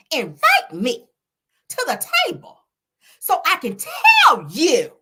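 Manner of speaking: high, loud imitation with a little attitude; Mel's voice going up. Showing how Mel's voice goes up, high and emphatic. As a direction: mimic her voice climbing, stretch the words. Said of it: "honey, her voice go up".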